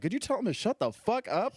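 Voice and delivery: high-pitched retold exasperation; mock exasperated request. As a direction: imitate an annoyed comic calling out. Imitates annoyed comic's request from stage.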